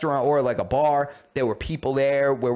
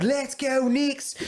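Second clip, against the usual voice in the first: high, drawn-out Australian fan cheer; mock Australian accent; 11 semitones higher, much slower than usual. Drinking UK tourist at the game, botched Australian-accent cheer. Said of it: "I don't know why I made him Australian".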